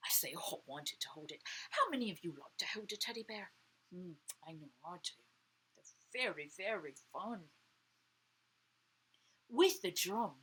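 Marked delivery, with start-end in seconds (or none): none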